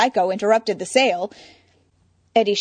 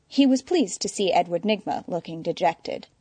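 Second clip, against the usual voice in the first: ordinary delivery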